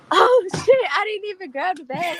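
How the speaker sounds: high, loud laughing gasp of panic; mock horror at forgetting the bag; retold shocked realization. Retelling to interviewer how she forgot wine, mock panic. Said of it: "I'm like".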